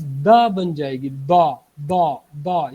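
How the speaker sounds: दा with the tongue tip hitting the front teeth, a plain dal; wrong dal sound. Shown as a demonstration. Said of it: "ये गलत है". (गलत दाल वाली आवाज़)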